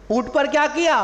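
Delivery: usual speaking voice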